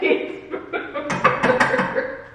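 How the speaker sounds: high, slow, voiceless with laughter; laughing. Real, not acted. Creator tries the phrase again, dissolving into laughter. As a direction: squeak it out through helpless laughter.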